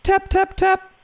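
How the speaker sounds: squeaky tap tap tap; high mock knock. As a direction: say the taps like a knock. Acting knock on window.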